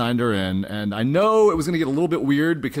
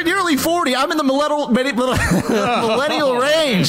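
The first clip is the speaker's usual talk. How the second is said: high, laughing protest; laughing indignation; 10 semitones higher, slower than usual. Real, not acted. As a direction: squeal it in mock outrage.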